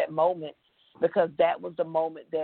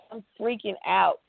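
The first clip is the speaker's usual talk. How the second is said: ordinary talk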